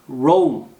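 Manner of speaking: "롬" with a gliding o-oo vowel; OU sound. Shown as a demonstration. Teacher corrects the Korean name of Rome, OU glide. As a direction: draw "롬" out as one syllable, o sliding into oo. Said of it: "오우 사운드 납니다".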